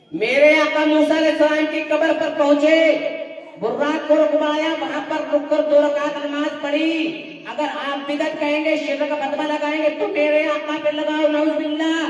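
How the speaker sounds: loud, sustained sermon declamation; full-force preaching. Real, not acted. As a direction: proclaim it to the whole congregation. (पूरे जोश में बुलंद तक़रीर)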